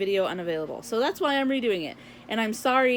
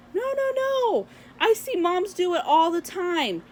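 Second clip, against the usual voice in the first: much higher, sweetly reassuring clerk voice; imitating the manager; 8 semitones higher than usual. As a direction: mimic the eager manager, pitched way up. Manager answers her politely.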